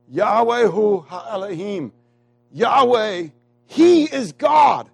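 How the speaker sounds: high, ringing shout, slowly stretched out; loud proclamation. Acted. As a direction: proclaim it to a whole congregation. Proclaiming the people's cry from scripture.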